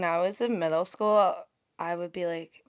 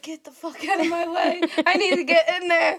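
higher-pitched, laughing imitation; mock bossy impression of friend; 8 semitones higher, 6 dB louder than usual. Teasing tiny friend pushing through at work, mock bossy. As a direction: tease her with a hammed-up pushy order. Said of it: "She's like".

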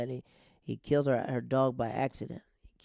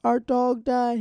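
high, loud hammed-up wail; put-on tearful cry; mock crying; 11 semitones higher, 7 dB louder, slower than usual. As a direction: imitate a girlfriend wailing in grief. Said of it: "And so she's crying".

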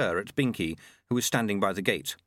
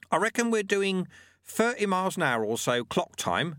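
put-on higher, patient old mentor voice; wise old monk; 8 semitones higher than usual. A narrator's reading. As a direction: teach the lesson like a wry old sweeper. Lutze voice.

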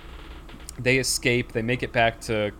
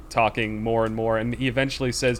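ordinary delivery

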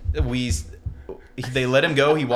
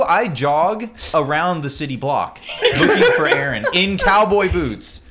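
laughing; 5 semitones higher, 5 dB louder than usual. Real, not acted. Laughing retelling jog.

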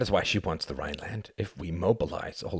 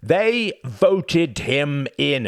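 much higher, louder, very slow; heated retort; 10 semitones higher, 9 dB louder, much slower than usual. Acted. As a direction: hammer each word out. Cuthbert retorts.

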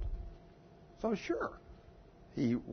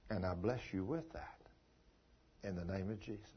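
softer, lower, fading to near hush; tender husband; 3 semitones lower, 5 dB quieter than usual. Retold or imitated. Husband blessing his leaving wife: softly tender, fading away. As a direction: bless her gently as she walks out. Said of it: "And he said".

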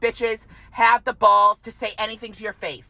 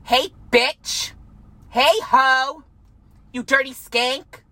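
higher, brash mock call-out; confrontational demo; 6 semitones higher, much slower than usual. Shown as a demonstration. Showing viewers how to confront a gossip, brash and higher. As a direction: show how to get in a gossip's face. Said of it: "you go up to them and you say".

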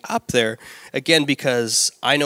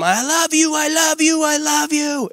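high repeated sing-song; husband's inner chant of love; inner insistent voice; 12 semitones higher than usual. Retold or imitated. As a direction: chant it high and eager, three times. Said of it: "So in my mind".

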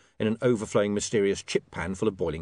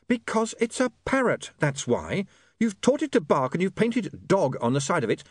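high, exasperated woman's voice; frayed patience, pitched up over a din; harassed Saccharissa; 11 semitones higher than usual. Acted. Saccharissa fends off people with wrong animals, exasperated.

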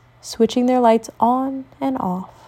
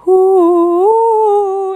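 high, loud ghostly ooh; long haunted wailing ooh; spooky wail; 11 semitones higher, 6 dB louder than usual. Acted. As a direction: wail to scare your sister. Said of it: "a really spooky wailing noise".

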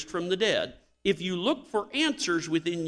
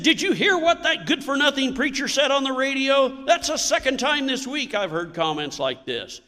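higher and louder indignant complaint; retold angry rant; 9 semitones higher, 5 dB louder than usual. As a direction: vent at the radio preacher with loud outrage. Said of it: "heard her husband shouting".

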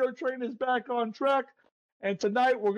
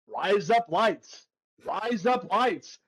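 slow, lower-pitched mock Australian "razor blades"; Australian accent demo; 4 semitones lower, slower than usual. Shown as a demonstration. Teaching listeners to say razor blades, slow Australian accent. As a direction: draw out three English words into an Australian accent. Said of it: "razor blades with an Australian accent".

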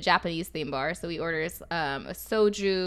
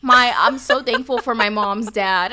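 imitating him, loud and drawn out; loud drunken declaration; 3 semitones higher, 11 dB louder than usual. Drunk guy boasting about his hairline, loud slow imitation. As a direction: proclaim it slowly at full volume, mock-earnest.

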